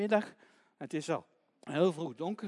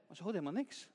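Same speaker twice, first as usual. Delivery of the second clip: usual speaking voice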